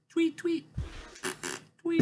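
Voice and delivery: squeaky little tweet noises; high mock bird tweets. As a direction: chirp it like a cartoon bird. Mimics checking his tweets.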